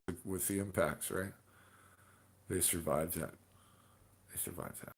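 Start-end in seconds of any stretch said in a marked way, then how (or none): 4.3-4.9 breathy whispered repeat. Shown as a demonstration. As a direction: whisper it back, hushed. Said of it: "repeated as whisper".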